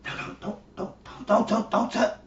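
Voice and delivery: hushed, frantic whisper; mock whispering. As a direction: whisper urgently to shush someone. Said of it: "you know, whispering".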